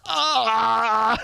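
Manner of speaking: a high excited "Ah!"; mock excited yelp. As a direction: yelp with thrilled surprise. Reaction to hype duty.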